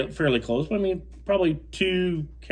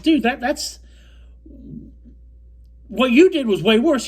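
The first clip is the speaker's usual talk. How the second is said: high, loud indignant reply; heated retort; 11 semitones higher, 4 dB louder than usual. Retold or imitated. The angler tells his partner his move was worse, high and loud. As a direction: fire back at him, pitched up and loud. Retelling a heated boat argument.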